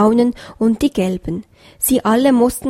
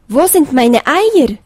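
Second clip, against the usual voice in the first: louder, higher desperate call; 4 semitones higher, 6 dB louder than usual. A narrator's reading. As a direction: cry out for the lost eggs. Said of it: "rief der Hase". (laut und aufgebracht rufen)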